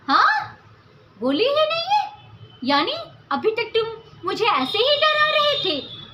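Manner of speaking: high, mocking disbelief; incredulous mockery. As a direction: tease him for the empty gun. Raina mocks empty gun.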